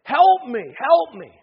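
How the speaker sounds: loud, high cries of a drowning swimmer; urgent acted shout for help; screaming for help. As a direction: yell for rescue at full voice. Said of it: "start screaming with all your lungs".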